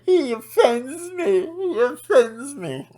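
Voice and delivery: high, slow mocking whine; mock a thin-skinned snowflake; whiny offended viewer. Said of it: "or are you gonna go".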